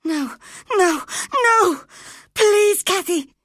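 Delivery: high, loud, panicked screaming; wild scream. Acted. Linton screams wildly, begging Cathy not to leave. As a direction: scream in terror, clinging on. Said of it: "screamed Linton wildly".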